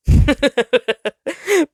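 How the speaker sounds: high, loud taunting laugh; cruel, gleeful laugh; mocking laugh. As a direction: cackle at the little star's fear. Sinister laugh at the star.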